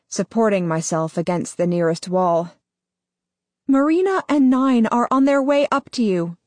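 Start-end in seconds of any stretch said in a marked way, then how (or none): 3.7-6.4 higher, firm, guiding voice; guiding woman's voice. Acted. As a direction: steer him through it calmly. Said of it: "Ella guides me".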